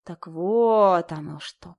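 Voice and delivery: slow, drawn-out realization. A narrator's reading. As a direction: stretch every word slowly. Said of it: "протянула я".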